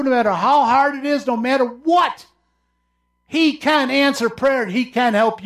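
high, loud preaching climax, pressing every line; preaching at full force. Acted. Preacher to congregation about God's word, full-force preaching. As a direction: preach it out, rising to the heavens.

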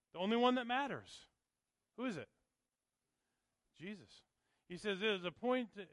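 loud, high, ringing sermon voice; fiery pulpit preaching; full-force preaching. Acted. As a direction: preach it out to the back row.

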